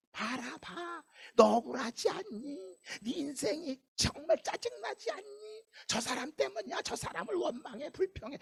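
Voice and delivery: high, hushed tempter's voice; whispering devil. Acted. Devil urges a person to blame others, whispering. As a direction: murmur temptingly into someone's ear, soft and high. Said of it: "속삭이는 거야".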